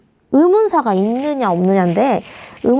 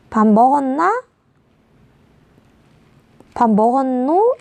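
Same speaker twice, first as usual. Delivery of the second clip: Gyeongsang dialect. Shown as a demonstration. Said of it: "경상도 사투리를 잠깐 생각을 해보면".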